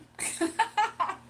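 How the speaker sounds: high, loud peal of laughter; cackling laugh. Real, not acted. Streamer stuck for a question, cackling laugh. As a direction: burst out laughing.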